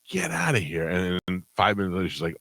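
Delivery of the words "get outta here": disbelief. Retold or imitated. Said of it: "So we were like".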